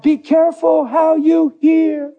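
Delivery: high, slow warning cry; yelling out. Acted. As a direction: call the warning out, stretching the words. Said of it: "He's yelling out".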